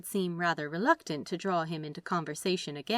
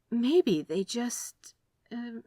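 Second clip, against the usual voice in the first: nervous; 5 semitones higher, much slower than usual. Acted. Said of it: "nervous twist of hands".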